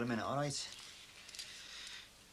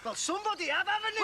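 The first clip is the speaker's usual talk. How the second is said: much higher, loud disbelieving accusation; incredulous fury; 4 dB louder than usual. Acted. Husband rounding on the friend who bought presents, high furious disbelief. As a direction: spit it back at him in disbelief.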